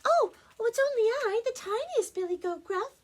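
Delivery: high, squeaky little billy goat; very small goat voice. Acted. Tiniest goat answers the troll, very small high voice. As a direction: pipe up loudly in a tiny high voice. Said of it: "in his very small voice".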